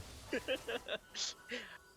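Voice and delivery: laughing. Real, not acted. Laughs at own joke.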